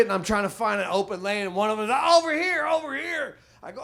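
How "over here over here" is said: calling out. Retold or imitated. Cashier calling him over.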